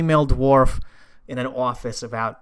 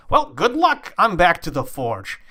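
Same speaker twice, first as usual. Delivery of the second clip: brisk hearty send-off; 6 semitones higher, 4 dB louder than usual. Acted. Dwarf brushes them off, leaving.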